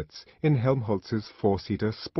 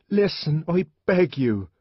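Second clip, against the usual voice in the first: high, louder pleading cry; earnest plea; 8 semitones higher, 5 dB louder than usual. Acted. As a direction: beg the crowd sincerely. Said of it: "cried the savage earnestly".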